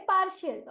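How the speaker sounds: पार्शियल said clearly as paar-shiyal; teacher modelling पार्शियल; model pronunciation. Shown as a demonstration. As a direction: articulate पार्शियल with a long aa. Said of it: "इस शब्द का उच्चारण हम करेंगे".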